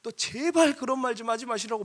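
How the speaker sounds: high-pitched retold pleading; acted-out startled warning; alarmed plea. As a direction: beg them urgently to stop. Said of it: "화들짝 놀라시면서 하시는 말씀".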